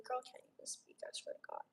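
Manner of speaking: breathy unvoiced aside; whisper. Real, not acted. Streamer whispering to herself about a chatter, whisper. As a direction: mutter it under your breath.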